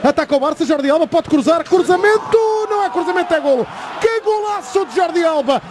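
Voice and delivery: excited goal call, pitch rising; fast dramatic football commentary. Real, not acted. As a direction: shout the goal, building fast.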